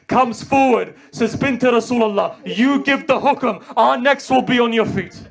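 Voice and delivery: high, loud grieving preacher's cry; impassioned recitation. Acted. Reciter retelling Hussein's urgent letter, loud impassioned lament. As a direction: lament it to the mourners at full force. Habib rallies the companions.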